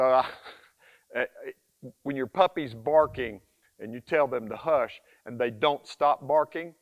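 ordinary talk

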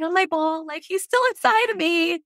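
high, animated disbelief; playful exasperation. Real, not acted. Mother bouncing on ball, high amused exasperation. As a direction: push it up high, amazed he's not out.

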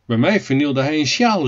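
ordinary delivery, nothing marked

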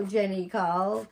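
usual speaking voice

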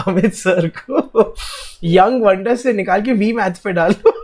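real laughter through the words; laughing. Real, not acted. Teacher relays students' protest demand, laughing as he talks. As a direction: relay it while cracking up, quickening.

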